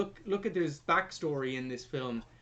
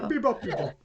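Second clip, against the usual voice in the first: put-on higher-pitched gibberish Italian accent; mock Italian accent; 6 semitones higher, faster than usual. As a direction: echo the silly Italian-accent babble. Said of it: "stereotypical Italian accent".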